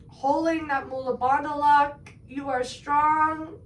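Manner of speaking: slow, strong, rising encouragement; rousing encouragement. Acted. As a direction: push them with a strong, drawn-out voice. Coach urging at top of breath.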